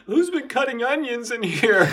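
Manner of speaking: high loud mock-weepy squeal; mock tearful. Teasing the group, a high mock-weepy wail. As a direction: pretend to be moved to tears. Mock crying joke about onions.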